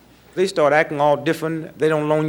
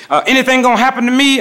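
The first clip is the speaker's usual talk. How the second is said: higher, louder, panicky little question; mock scared cop; 7 semitones higher, 6 dB louder, faster than usual. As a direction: play the cop suddenly frightened. Scared cop asks comedian.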